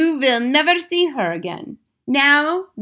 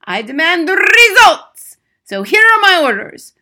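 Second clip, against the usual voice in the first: high, loud tyrant giving orders; shrieking Grand High Witch; 10 semitones higher, 12 dB louder than usual. A narrator's reading. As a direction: bark out commands in an accented shriek. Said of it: "shrieked the Grand High Witch".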